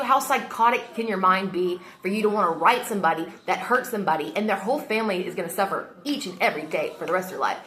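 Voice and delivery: usual speaking voice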